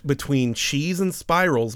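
ordinary talk, nothing marked

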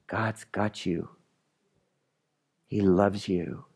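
low, hushed and unhurried comfort; soft, low pastoral comfort; tender reassurance. Real, not acted. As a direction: gently reassure a worried congregation, leaving room between phrases. Preacher reassures congregation after rousing passage.

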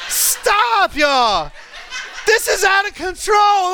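high, laughing "don't"; laughing protest. Real, not acted. To the congregation at the son's photo, laughing. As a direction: laugh while begging them to stop.

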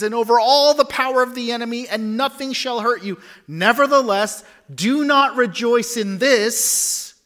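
high, loud preaching of Jesus' words; emphatic proclamation. Acted. Jesus commanding the disciples, loud and emphatic. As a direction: declare it with full force.

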